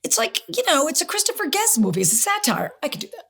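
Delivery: light up as it finally clicks; sudden realization. Retold or imitated. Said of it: "I go".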